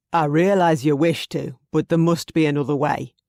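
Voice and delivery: a hint of a Scottish lord; lightly voiced firm Scotsman; lightly voiced Highland man. Archie voiced by narrator.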